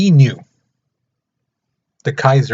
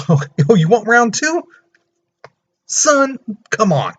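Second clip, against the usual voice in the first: higher, cocky mock challenge; taunting Germany; 9 semitones higher than usual. Germany taunting France for a rematch, higher and cocky. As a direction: sneer at them, pitched well up. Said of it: "So then Germany says".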